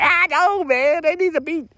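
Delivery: hammed-up female worker; imitating the worker. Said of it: "She was like".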